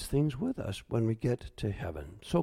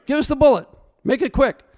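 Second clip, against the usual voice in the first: higher, louder defiant call; defiant cry; 10 semitones higher, 9 dB louder, faster than usual. Retold or imitated. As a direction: shout it out, ready to die. Pastors defiantly choosing death.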